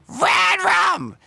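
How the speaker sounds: very high, loud tight-throated screech; screechy, tight-throated. Shown as a demonstration. Singer showing how a scream is built, screechy. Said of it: "that screechiness, tightening your throat".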